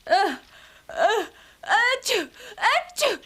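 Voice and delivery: high gasps then two sneezes; gasp, gasp, then sneeze twice; sneeze build-up. Acted. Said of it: "Milky Ann sneezes like that".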